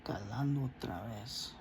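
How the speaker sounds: breathy hushed aside under the breath; whisper. Real, not acted. A hushed aside so no one nearby hears, whispered. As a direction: keep it to a secret whisper. Aside muttered while recording.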